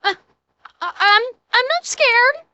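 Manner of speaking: high, stammering imitation of fear; hammed-up frightened squeak; mock shaking voice. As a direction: mimic someone too scared to say it. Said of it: "the shaking in his voice".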